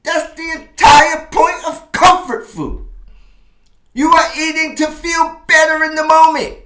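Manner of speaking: high, loud, exasperated protest; high, loud, wounded indignation; indignant rant. Real, not acted. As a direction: pitch up high and loud in offended disbelief. Indignant rant at girlfriend's lecture.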